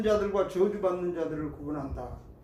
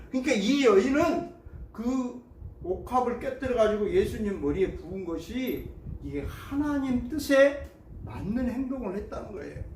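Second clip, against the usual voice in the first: normal talking voice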